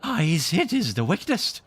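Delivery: high, loud admiring exclamation; admiring cry. A narrator's reading. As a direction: gush with awe at the plan. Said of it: "he cried".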